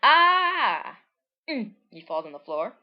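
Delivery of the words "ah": loud, high cartoon scream; high, loud startled shriek. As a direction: shriek as the blast hits. Character hit by explosion.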